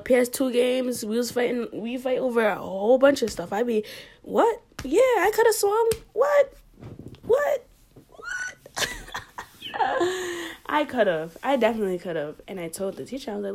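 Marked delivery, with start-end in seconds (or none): 4.2-8.9 mock squabbling shout. Fighting her brother over video games, squeaky mock shouting. 8.9-10.6 laugh. Real, not acted. Laughs at own reenactment.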